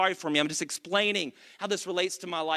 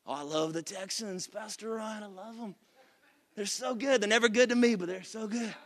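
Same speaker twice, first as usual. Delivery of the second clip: mock churchgoer voice; 6 dB quieter than usual. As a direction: tease a congregant gushing about the Texans. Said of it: "I don't know why you're talking like this".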